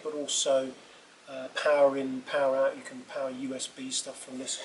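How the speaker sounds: usual speaking voice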